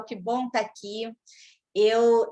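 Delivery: ordinary talk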